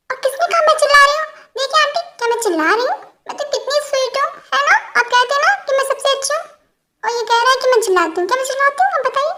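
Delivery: fast high-pitched whiny appeal; mock innocent. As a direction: play the sweet wronged girl. Girl protests to aunt, mock sweet.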